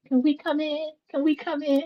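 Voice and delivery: high, hushed, eerie plea at the door; creepy vampire asking. Retold or imitated. Vampires asking to be let into the house, high and hushed. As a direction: ask sweetly like a vampire begging entry. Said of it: "comparing them to the vampires from Sinner".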